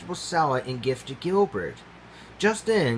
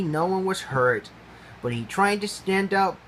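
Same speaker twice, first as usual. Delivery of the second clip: usual speaking voice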